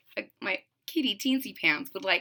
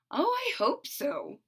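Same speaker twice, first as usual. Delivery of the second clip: hopeful mother; 7 semitones higher, 4 dB quieter, slower than usual. A narrator's reading. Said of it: "She patted her belly and beamed".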